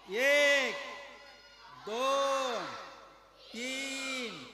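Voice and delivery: shouted, drawn-out count, one number at a time; loud count. Shown as a demonstration. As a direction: call out each number loudly for everyone to join in. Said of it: "जोरात, जोरात बोला". (जोरात, लांबवून मोजणे)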